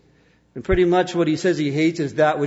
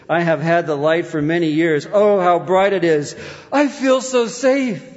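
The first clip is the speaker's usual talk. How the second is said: acted dying joy; 2 semitones higher, 4 dB louder than usual. Preacher voices dying Brewster seeing light, rising joy. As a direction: build towards rapturous relief.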